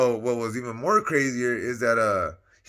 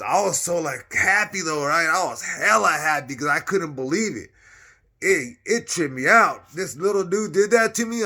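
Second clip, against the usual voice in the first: excited; 6 semitones higher, 7 dB louder than usual. Real, not acted. Delighted after getting choked.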